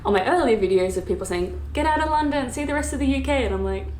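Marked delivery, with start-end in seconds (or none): 1.7-3.4 high-pitched imitation of commenters; mock nagging. Quoting nagging commenters on videos.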